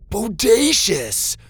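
high, loud, drawn-out whoop; excited shout. A narrator's reading. Star trading shouts with his robot, long excited whoop. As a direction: stretch it into a thrilled shout. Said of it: "exchanged excited shouts".

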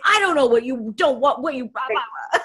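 put-on half-playful exasperated snap at the child; worked up. Shown as a demonstration. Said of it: "I was getting worked up".

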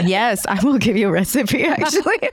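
rising into laughter; laughing. Real, not acted. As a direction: answer while starting to laugh. Laughing reply.

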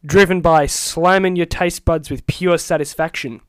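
upbeat advert announcer voice; ad read. Pretend. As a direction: sell it like a snack commercial. Reading the Doritos bag ad copy.

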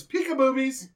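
high, singsong mock peekaboo; playful peekaboo voice. Host retelling a horror scene, playful peekaboo voice. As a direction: pop it out high like a silly peekaboo. Acts out a gory film moment.

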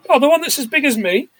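mock boy voice. Boy's reply voiced.

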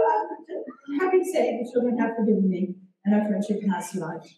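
ordinary delivery

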